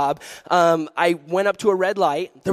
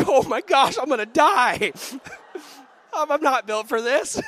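mock panic; 8 semitones higher than usual. Panics facing the gangster.

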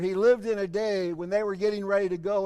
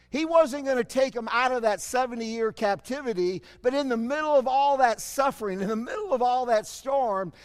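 usual speaking voice

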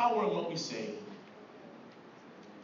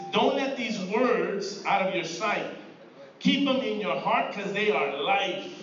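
slow, louder preaching of the verse; preaching scripture; 2 semitones higher, 5 dB louder, slower than usual. Acted. Preacher quoting Solomon to the church, slow and forceful. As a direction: drive the verse home slowly. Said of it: "that's why he's saying this".

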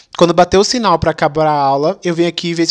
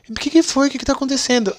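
caring teacher; 7 semitones higher, 4 dB quieter than usual. Retold or imitated. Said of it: "Ei, meu príncipe".

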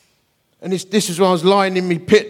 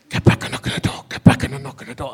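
whispered inner voice; 4 semitones lower than usual. Retold or imitated. God's inner voice nudging the preacher, whispered.

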